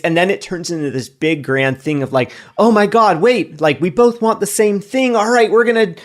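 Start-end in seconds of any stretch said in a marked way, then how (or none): 2.6-6.0 hopeful excited gush. Retold or imitated. Acting hopeful reconciling partner.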